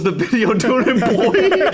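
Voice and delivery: breaking up laughing at the punchline. Real, not acted. Telling the printer story to friends, laughing. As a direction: voice jumps high into a laugh.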